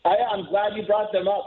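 ordinary delivery

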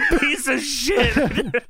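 slow, laughing promo snarl; wrestling promo. Retold or imitated. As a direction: drag out the insult, laughing. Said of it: "So he goes".

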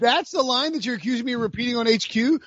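puffed-up fake offense; mock indignant. Mock outrage at being accused.

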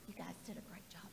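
whispered praise. Real, not acted. Pastor whispering praise to the children after prayer.

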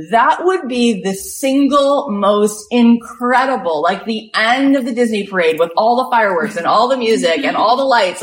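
loud, high, gushing build-up; over-the-top excitement. Acted. Imagining the end of the Disney parade, over-the-top excitement. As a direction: stretch the opening big, then rush through the list. Acting out the most thrilling channel.

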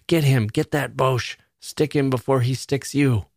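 half-hearted drill-yard bawl; mock drill bawling. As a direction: lightly imitate the bawling instructors. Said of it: "They kept bawling at us".

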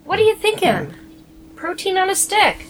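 mock outrage. Mock outrage over a vote.

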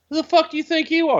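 high, fast, offended woman's voice; indignant young lady. Retold or imitated. Young woman snapping back at [name], high and fast. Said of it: "And she's like".

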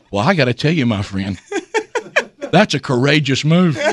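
friend's low little voice, imitated. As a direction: drop low and small as the friend. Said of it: "With that little voice".

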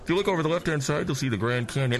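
calmly downplay the disaster like a pilot; announcing to the cabin, smooth pilot voice; airline pilot voice. Acted. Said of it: "your pilot voice comes on".

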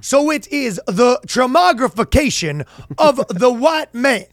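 slow, high, grandiose lecturer. Retold or imitated. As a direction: stretch every word like a pompous scholar. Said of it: "This is my one accent that I'm good at".

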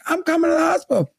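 high, rushed mock-mom voice; imitated panicked mom. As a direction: blurt it out like an alarmed mother. Retelling worried mother on phone.

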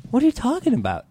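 ordinary talk

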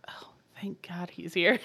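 hushed, breathy retold relief; soft aside under the breath; whispered relief. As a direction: whisper it as her relieved thought.

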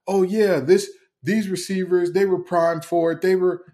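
mock agreement. Mimicking fans who believe in these receivers, mock agreement.

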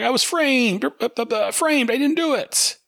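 high, panicky cartoon whine; protesting Punch. Punch pleading innocence to Waller, high panicky whine. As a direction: stammer out your innocence up high.

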